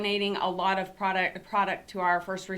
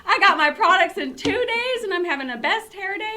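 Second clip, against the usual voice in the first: high, loud, giddy retelling of a voicemail; excited customer voicemail; 11 semitones higher, 6 dB louder than usual. Retold or imitated. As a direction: imitate a gushing, thrilled customer. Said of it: "but she's like".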